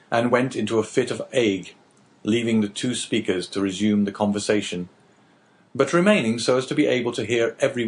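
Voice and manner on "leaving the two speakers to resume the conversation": ordinary talk, nothing marked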